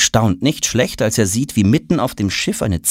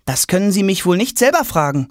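annoyed; 10 semitones higher than usual. Acted. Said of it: "fragt Tom verärgert".

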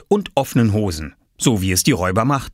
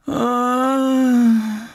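long high moan; groan. Acted. Unwell mother turns over in bed, long groan. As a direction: let out a drawn-out, high groan. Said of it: "stöhnte Mama".

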